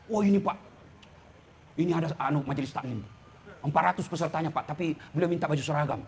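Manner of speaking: low, soft, confiding pitch to the boss; quietly hint at a favor, low and deferential; lightly mimicked aide's murmured sales pitch; wheedling campaign aide. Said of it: "Kalau percayakan sama tim sukses".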